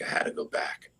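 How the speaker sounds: a hushed whisper, almost to himself. Real, not acted. Telling friends of the pull back to the ridge, whispered. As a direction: breathe it out as a whisper. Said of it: "I don't know why I had to go back".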